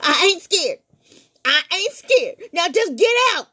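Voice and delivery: shrill defiance; boy bluffing through fear; scared protest. Acted.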